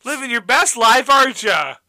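high, loud, sing-song waving bystander; cheerful onlooker. Pretend. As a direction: chirp down to him cheerfully. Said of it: "What if they just thought".

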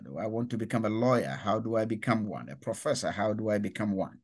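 normal talking voice

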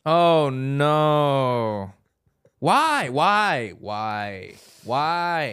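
hammed-up wails of why; overacted grief at the news; mock despair. Shocked at pescatarian confession.

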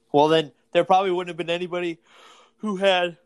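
talking through a yawn; words stretched by a real yawn. Real, not acted. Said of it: "you yawning?".

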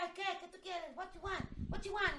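high-pitched taunting imitation; playful confrontational teasing; mock challenge. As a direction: goad a bird, re-enacted. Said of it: "le decía".

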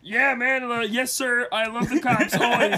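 high, Kermit-like overly polite voice; Kermit Mahomes. Retold or imitated. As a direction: Kermit the Frog doing a nervous yes sir. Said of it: "that's his Patrick Mahomes".

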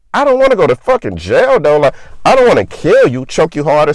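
alarmed protest. Retold or imitated. Telling his partner he won't risk killing her, high alarmed protest.

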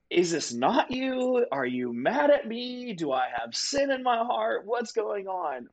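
high, slow, distressed questioning; anxious pleading. Retold or imitated. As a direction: plead with God, hurt and confused. Retold anxious prayer to God.

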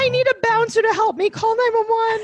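very high, panicky lady voice; squeaky scared lady. Pretend. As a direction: shriek for help like a frightened lady. Mocking friend's robbery plea.

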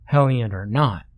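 usual speaking voice

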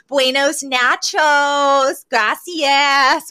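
drawn-out, high mock-Spanish attempt; bad American Spanish. Recapping Sylvia and Fran attempting Spanish, hammed-up bad Spanish. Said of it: "attempt to speak Spanish".

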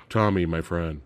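low, soft, unmoved line reading; deadpan bad acting. Host mocks bazooka guy seeing friend die, deadpan bad acting. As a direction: imitate an actor showing no grief.

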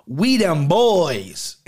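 put-on slow, drawn-out "We them boys"; mock victory boast. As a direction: savour the brag like a champion. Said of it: "looking in this camera, going".

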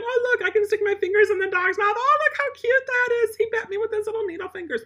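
mock a doting owner cooing at the puppy; mocking silly owner. Mocking owners who let puppies chew fingers, high gushing coo. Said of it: "people do that all the time".

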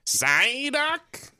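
high-pitched cartoon creature cry; strained, drawn-out high squawk; Psyduck impression. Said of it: "you can do it".

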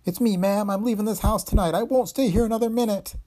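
high, loud, panicky; terrified cook blurting out; frightened maid. Acted. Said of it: "by her tone she was terribly frightened".

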